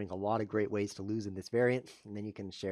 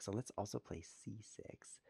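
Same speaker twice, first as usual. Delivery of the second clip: whispered aside; 11 dB quieter, slower than usual. Real, not acted. Muttering a move to himself.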